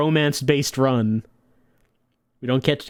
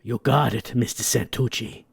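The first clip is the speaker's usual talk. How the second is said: lower, softer yes-man; lightly voiced henchman; 4 semitones lower, 4 dB quieter than usual. Henchman answering his boss, low and obedient. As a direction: mutter it like an obedient goon.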